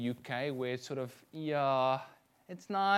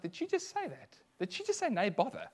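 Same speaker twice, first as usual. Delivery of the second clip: much higher, incredulous retold disbelief; disbelieving surprise; 7 semitones higher than usual. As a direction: replay the shock of hearing it. Retelling shock at waitress's reply.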